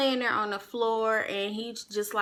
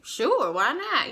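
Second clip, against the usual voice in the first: high, drawn-out, louder yes; breezy acceptance; 5 semitones higher, 5 dB louder, slower than usual. Retold or imitated. Accepting a friend's drink offer in the club, breezy. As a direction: agree airily, stretching the words.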